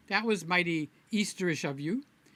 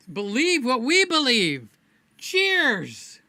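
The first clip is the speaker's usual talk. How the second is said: raised-voice cheers, pitched up; hearty toast; 5 semitones higher, 6 dB louder, slower than usual. Real, not acted. Toasting his livestream viewers with a loud cheers. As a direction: lift the glass and call it out.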